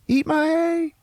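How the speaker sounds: very high-pitched cheeky holler; taunting mock call. Brother running off to gamble, taunting mock holler. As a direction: jeer it back while getting away.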